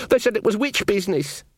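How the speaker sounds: high, plaintive soldier's wail. Acted. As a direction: wail it in self-defence to the queen. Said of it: "Sean wailed".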